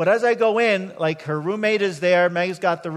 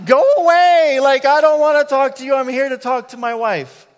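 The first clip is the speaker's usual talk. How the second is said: loud, much higher exasperated brush-off; go away; 7 semitones higher, 10 dB louder than usual. Retold or imitated. Husband telling chatty roommate to go away, loud exasperated. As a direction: shoo her off, loud and fed up.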